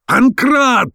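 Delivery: high, frenzied call for a servant; furious shout. A narrator's reading. Professor summoning Pankrat in fury, shouting. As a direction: yell the name high in a rage. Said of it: "закричал профессор в бешенстве".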